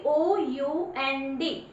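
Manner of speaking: O यू N D drawn out letter by letter; slow spelled letters. Shown as a demonstration. Teacher to class, spelling letters very slowly. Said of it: "कौन सी लिखी जाएगी".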